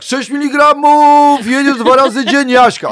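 very high, loud, slow diva voice; a star announcing a dose from the stage. Retold or imitated. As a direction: sing it out grandly like a diva doctor. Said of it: "I nagle [name]".

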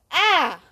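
high, brief "ah" of exasperation; short frustrated cry. Real, not acted. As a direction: let out a quick high yelp. Mock crisis cry.

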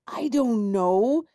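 high, loud, drawn-out retort; shot back. A narrator's reading. Knight shooting back at Squirrel while retreating, loud retort. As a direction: throw it back with a rising end. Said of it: "the knight shot back".

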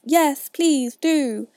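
loud, drawn-out group plea; stretched, eager begging; chorus. A narrator's reading. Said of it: "the others all joined in chorus".

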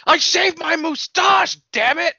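high, loud, drawn-out mock yell; hammed-up exasperated shout; acted outburst. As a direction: yell it slowly in comic indignation. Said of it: "And he goes".

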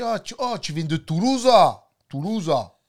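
Marseillais exclamatif qui monte et ouvre grand les finales; accent marseillais. Shown as a demonstration. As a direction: imite Marseille avec une finale appuyée sur «Toulouse». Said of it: "À Marseille". (accent de Marseille démonstratif)

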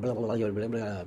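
low, soft gabbled nonsense; garbled speech. Retold or imitated. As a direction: run BLAH together in a mumbled blur. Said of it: "Maybe she's right".

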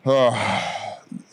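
long weary exhale; heavy sigh. Real, not acted. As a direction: let out a big sigh. Sighs before answering the question.

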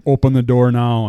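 loud barked order; demanding shout. Retold or imitated. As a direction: call it out as a command. Judge demanding entry.

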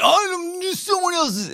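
very high, drawn-out mock voice; mock imitation of teammate. Host teasing a passionate teammate, squeaky mock imitation. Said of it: "that's not how he talks".

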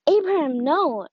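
high, loud cry to stop him; urgent angel's call. Acted. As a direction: shout his name to halt the knife. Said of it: "the angel was like".

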